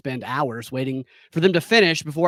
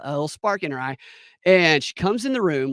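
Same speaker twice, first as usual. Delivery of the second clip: normal talking voice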